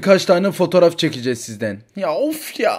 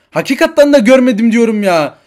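louder, higher, fed-up insistence; exasperated protest; 6 semitones higher, 9 dB louder than usual. Acted. Accused woman insisting to the police, exasperated. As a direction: push back hard, you're sick of this.

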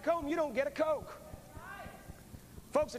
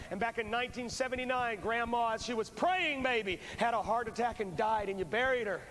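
ordinary talk

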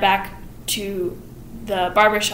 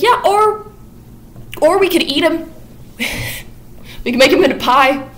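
higher, louder, gleeful with a laugh; eager scheming; 7 semitones higher, 3 dB louder than usual. Retold or imitated. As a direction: pitch the idea with giddy delight. Miss Levitt excitedly proposing pies.

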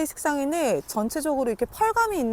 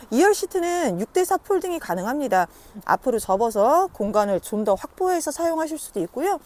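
ordinary talk, nothing marked

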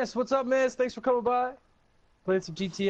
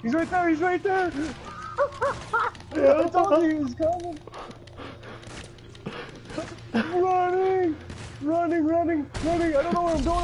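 high frantic panicked shouts; panicked yelling; 14 semitones higher than usual. Real, not acted. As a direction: yell in real panic while fleeing. Chased by killer in game.

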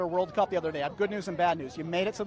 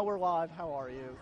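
ordinary talk, nothing marked

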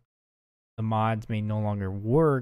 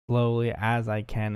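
ordinary delivery

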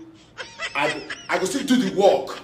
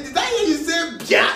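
Abacha's shout; 11 semitones higher, 5 dB louder than usual. Retold or imitated. Abacha demanding in retold story.

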